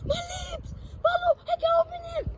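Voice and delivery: panicked. Real, not acted. Burning lips, struggling with bottle.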